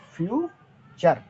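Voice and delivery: Future stretched slowly, syllable by syllable; future said very slowly and clearly; drawn-out model word. Shown as a demonstration. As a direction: drag out future for students to copy. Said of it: "ध्यान देना".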